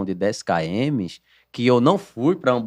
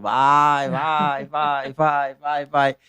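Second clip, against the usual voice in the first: chanted urging, only slightly raised; mock yelling; 3 semitones higher, much slower than usual. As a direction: imitate a father egging on a learner driver. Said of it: "E os gritos". (gritos imitados, sem força total)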